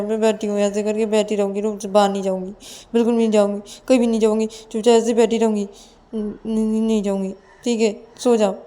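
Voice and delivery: hurried, flustered protest, high at first; tearful denial. Acted. Ananya wipes her tears and denies crying to Payal, tearfully flustered. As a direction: deny it plaintively, then settle down. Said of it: "अपने आंखों से आंसू पोंछते हुए".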